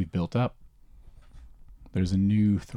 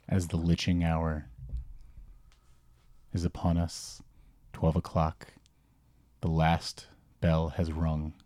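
ominous narration; 4 dB quieter than usual. A narrator's reading. GM scene closing narration.

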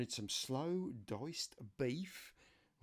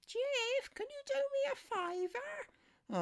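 much higher falsetto voice for his niece; niece's voice. Retold or imitated. Niece phoning her uncle for help, high falsetto. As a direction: play the niece asking a favour, pitched way up. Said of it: "this is my niece Laura I'm talking about".

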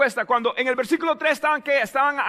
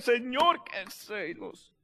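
praying aloud, trailing into a slow, very soft finish; Nehemías; 8 dB quieter, much slower than usual. Acted. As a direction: draw out the last words to God. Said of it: "dígale como Nehemías".

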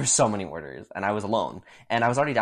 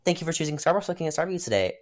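lightly voiced scripted barista greeting, higher and fast; 6 semitones higher, faster than usual. Barista greeting a customer at work, mock chirped script. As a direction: rattle off a mock drive-thru welcome, pitched up. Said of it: "I'm gonna go".

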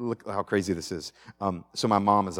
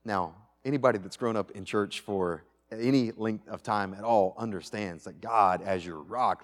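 usual speaking voice

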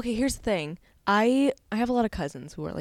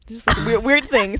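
mocking weird; 4 semitones higher, 8 dB louder, slower than usual. Mocking cousin brag.

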